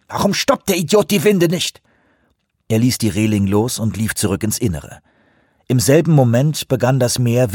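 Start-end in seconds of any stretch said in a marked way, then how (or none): 0.1-1.8 angry. Acted. Furious at winch operator.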